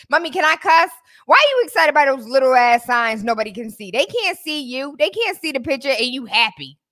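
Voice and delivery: high, loud teasing scold; sassy teen daughter. Retold or imitated. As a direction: voice the daughter roasting her mom. Voicing teenage daughter's scolding.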